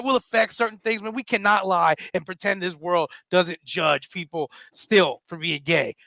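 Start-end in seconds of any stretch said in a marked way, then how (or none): none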